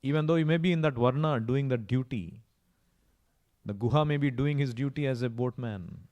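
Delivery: ordinary talk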